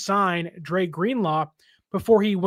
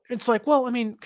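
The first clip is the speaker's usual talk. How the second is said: ordinary talk, nothing marked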